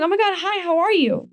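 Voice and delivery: high, gushing hello; bubbly high-pitched hi to old friends; excited greeting. Retold or imitated. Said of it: "I'm excited to see everyone".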